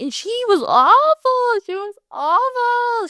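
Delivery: very high, slow, drawn-out outrage; high, slow, disgusted wail; squealed emphasis. Real, not acted. Emphatic judgement of abusive ex.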